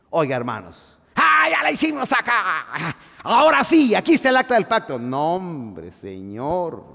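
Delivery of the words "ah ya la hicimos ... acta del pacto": high, loud, gloating cheer; the Israelites crowing over the ark; triumphant crowd. Acted. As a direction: celebrate like soldiers who think they've won. Voicing the Israelites' celebration.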